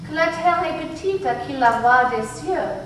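musical intoning. A narrator's reading. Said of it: "intoned musically".

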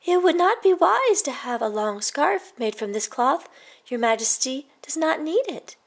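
put-on boy advising the greedy king; boy's voice. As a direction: warn the king gently as a boy.

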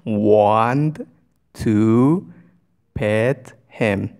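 very slow, stretched words with gaps; word-by-word dictation. Shown as a demonstration. Teacher asks the learner's question for them, slowly, word by word. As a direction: draw out each word for learners.

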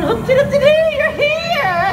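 high, singsong flourish; high-pitched gleeful reveal; excited ta-da. Acted. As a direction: leap up high on a playful, triumphant announcement. Gleeful ta-da of arrival.

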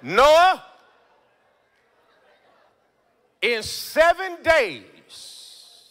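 loud call then very slow stretched words; booming God voice, drawn out. Acted. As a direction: boom it out as God. Voicing God dramatically.

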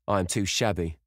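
plain reading voice

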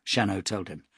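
ordinary delivery, nothing marked

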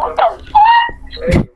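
drawn out slow and very high; stunned what the fuck. Retold or imitated. Telling his friend about the bouncy house, slow shocked high cry. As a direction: pitch it way up in disbelief. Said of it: "I'm like".

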